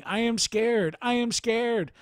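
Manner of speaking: a terrified villager repeating her fear; frightened woman. Retold or imitated. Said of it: "She kept saying".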